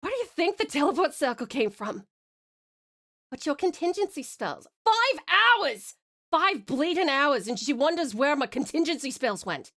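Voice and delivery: indignant, exasperated bad Scottish accent; amateur Scottish accent. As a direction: complain in a broad, butchered Scottish accent. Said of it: "butchered it with my terrible accent".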